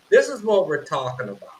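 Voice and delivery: ordinary talk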